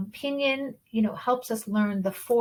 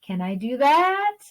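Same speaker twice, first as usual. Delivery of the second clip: THAT sweeps up high at the end; question intonation; 5 semitones higher, 5 dB louder than usual. Shown as a demonstration. Teacher likening second tone to English questions, THAT rising high. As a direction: ask it with THAT rising and louder. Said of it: "go up a little bit at the end".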